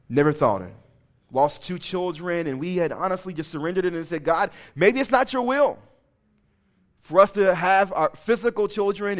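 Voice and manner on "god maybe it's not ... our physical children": high, loud, pleading prayer; earnest prayer. Retold or imitated. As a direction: pour out the surrender to God. Retold surrendering prayer.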